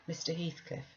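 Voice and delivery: ordinary delivery, nothing marked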